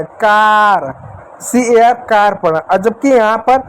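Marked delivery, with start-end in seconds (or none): 0.0-0.9 कार with a long आ sound; pronouncing car. Shown as a demonstration. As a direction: stretch the आ in कार. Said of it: "कार में इस ए को आ पढ़े".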